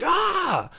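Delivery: high mock scream at moderate volume; internal voice screaming. Shown as a demonstration. Singer explaining the bridge to the A and R guy, high mock scream. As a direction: imitate a tormented rock scream, held back. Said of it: "his internal voice screaming out".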